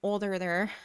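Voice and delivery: ordinary delivery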